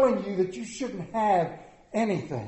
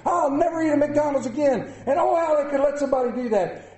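mock outrage; 6 semitones higher, 4 dB louder than usual. Voicing outraged Facebook commenters.

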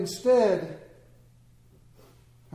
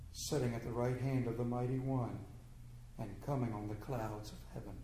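soft, low and steady; quiet calm reply; 7 semitones lower, 12 dB quieter than usual. Acted. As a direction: answer the accusers with quiet dignity. Jesus answers calmly before accusers.

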